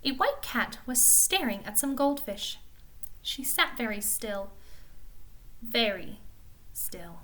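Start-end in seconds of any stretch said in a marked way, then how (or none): 3.2-7.2 soft, halting narration sinking to a near whisper; hushed stillness. A narrator's reading. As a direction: let each word drop quieter, with pauses between. Narrator evokes a motionless watching cat.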